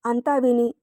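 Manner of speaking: ordinary delivery, nothing marked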